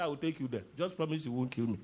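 low, soft servant's voice; acted meek pleading. Revived Egyptian bargains with David, low and meek. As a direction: bargain quietly for your life.

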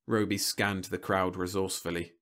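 usual speaking voice

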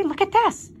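put-on high, loud boy's cry; high-pitched urgent call. A narrator's reading. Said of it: "cried Avery".

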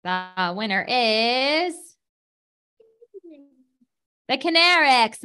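booming prize announcer. Acted. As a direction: hold the suspense, then call it. Announcing grand prize winner.

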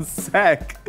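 mock Orange County "sick"; Orange County accent. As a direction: imitate your own Orange County accent. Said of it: "natural regional accent being from Orange County".

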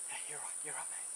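softly reassuring murmur; soft soothing. Real, not acted. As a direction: talk him down quietly. Calming the snake.

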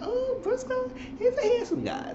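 high falsetto swoon; mock swooning. Gushing over Briscoe on the show, in a high swooning falsetto. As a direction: coo it like a smitten fan.

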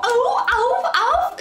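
high squeaky ow sounds; dolphin squeaks. Retold or imitated. Dolphin noise imitation.

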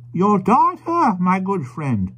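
put-on high, slow old gentleman; polite old man misjudging the couple; slow, high stranger voice; old stranger's voice. Old stranger speaking to the carrier.

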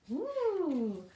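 ordinary delivery

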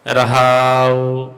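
slow held ਰਹਾਉ; drawn-out rahao. Shown as a demonstration. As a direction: draw out ਰਹਾਉ slowly. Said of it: "ਪੜਨਾ ਹੈ". (ਰਹਾਉ ਲੰਮਾ ਖਿੱਚ ਕੇ)

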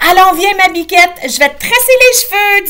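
high, loud coaxing call; cheerful calling. A narrator's reading. Grandma calls her daughter to be braided. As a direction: lure her in playfully.

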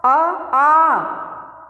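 a long, stretched "आ"; drawn out aa. Shown as a demonstration. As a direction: pull "आ" out long with breath. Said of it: "खींच के आ".

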